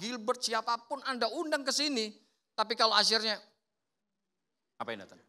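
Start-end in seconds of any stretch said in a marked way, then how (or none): 4.8-5.2 low, soft, dismissive mutter; rude usher. Acted. Unfriendly usher greeting a returning visitor, low and dismissive. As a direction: brush the visitor off.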